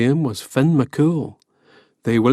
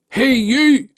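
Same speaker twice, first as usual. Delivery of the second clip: high, carrying call across the sea; 11 semitones higher, 3 dB louder than usual. Acted. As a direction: hail a distant giant. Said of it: "he called out to the distant massive shape".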